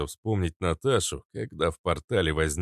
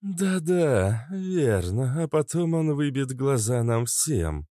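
gloomy; 7 semitones higher, slower than usual. Acted. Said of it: "угрюмо произнесла Кобра".

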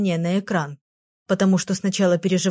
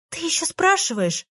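indignant; 9 semitones higher than usual. A narrator's reading. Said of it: "возмутилась я".

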